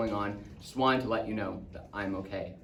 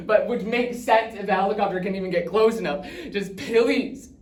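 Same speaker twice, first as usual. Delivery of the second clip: loud, high, desperate voice broken by coughs; panicked pleading; 7 semitones higher, 8 dB louder than usual. Acted. Dad trapped, begging his child to pick up, panicked. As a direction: shout down the phone, fighting for breath. Dad's desperate last phone message.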